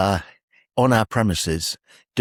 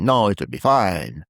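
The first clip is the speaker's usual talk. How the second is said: slower, imitated Italian accent; slower than usual. Tree man waving off counting the cash, Italian accent. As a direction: put on an Italian accent, drawn out. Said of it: "In his Italian accent".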